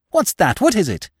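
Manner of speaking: high, startled questions; stammered alarm. A narrator's reading. Widow hears the window smash, alarmed. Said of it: "stammered the widow".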